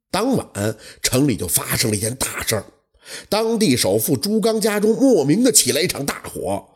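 usual speaking voice

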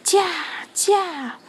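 high, drawn-out driver's call; calling to reindeer. A narrator's reading. Santa driving his reindeer, urging call. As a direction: urge the team on.

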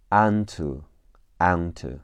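"onto" with an open ah, "ahn-too"; slow American "ahn-too" for "onto"; American pronunciation. Shown as a demonstration. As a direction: open the first vowel of "onto" to ah. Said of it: "美式发音是啊".

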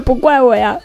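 ordinary talk

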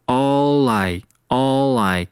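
"all I" run together as one slow word; slow teacher's demo linking "all I"; linked pronunciation. Shown as a demonstration. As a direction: link "all I", the l gliding into I. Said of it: "有一个连读".

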